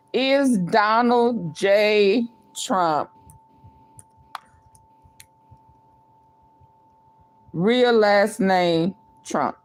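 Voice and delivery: slow, even poll read-out; slow careful dictation; dictating slowly. Acted. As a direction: read it slowly like you're typing it. Reading the poll out again.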